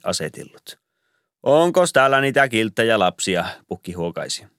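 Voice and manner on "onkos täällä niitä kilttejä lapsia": higher, louder jolly Santa call; classic Santa question. A narrator's reading. Santa playing his role while digging through presents, jolly and high. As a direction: sing out the old Santa line. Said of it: "Santa's ritual question".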